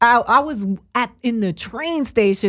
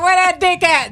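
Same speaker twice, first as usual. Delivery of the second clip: mock eager gossip; 7 semitones higher than usual. Mocking the blogger who outed Dwight Howard, gleeful high voice. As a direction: gleefully ask for it. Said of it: "He'd have been like".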